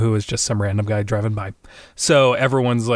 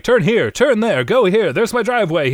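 high, insistent backseat orders; pitched-up frantic passenger; mock frantic directions; 9 semitones higher than usual. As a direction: direct the driver in hammed-up urgency. Acts frantic passenger giving directions.

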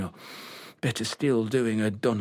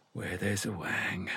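soft, low whispered pun; quiet wordplay; 5 semitones lower, 8 dB quieter than usual. Real, not acted. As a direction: murmur the joke to himself. Muttered pun, unvoiced.

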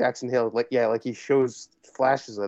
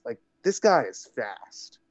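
usual speaking voice

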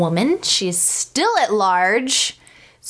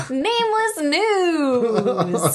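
announcer voice; 7 semitones higher, much slower than usual. Pretend. Naming the segment on the spot.

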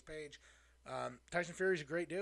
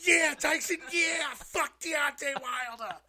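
slow, much higher, loud British shout; accent popped out; 12 semitones higher, 11 dB louder, much slower than usual. Real, not acted. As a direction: yell along in a borrowed British accent. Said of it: "I don't know where my accent came from".

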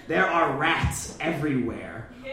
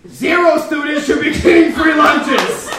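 shouting; 8 semitones higher, 7 dB louder than usual. Acted. Outraged rant at kids.

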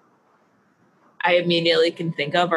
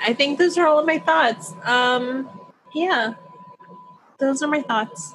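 silly high voice; 6 semitones higher, slower than usual. Pretend. Silly sign-off voice.

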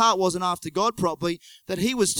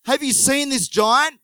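soldier asking; 7 semitones higher, 6 dB louder than usual. Acted. Soldier's question in Bible retelling.

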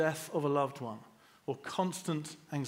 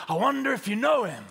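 high and loud, imitating the old preacher; fired-up preacher; 8 semitones higher, 8 dB louder than usual. Quoting the preacher he admires, loud and high. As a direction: ring it out like a Pentecostal preacher. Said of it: "I love the way he says".

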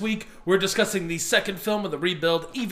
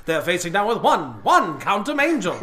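loud, high breaking news read; hammy TV anchor announcement; 4 semitones higher, 5 dB louder than usual. Pretend. Mock breaking news report.

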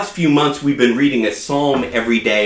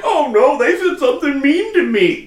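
much higher, whiny mock hurt; mock insecure God; 12 semitones higher than usual. Preacher mocking an insecure God for congregation, whiny and high. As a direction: squeak it like a wounded, petty god. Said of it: "He's not like".

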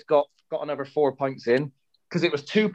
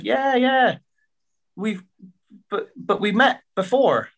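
put-on older landlady voice, pitched much higher; Lynn's voice; 6 semitones higher, slower than usual. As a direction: lift the voice high and motherly for the mum. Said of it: "she said".

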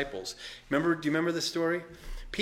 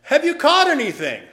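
high, loud call across the water; 14 semitones higher, 13 dB louder than usual. Retold or imitated. Jesus calling from the shore to the fishermen, loud and high. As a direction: shout it from the shore to the boat. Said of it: "He says".

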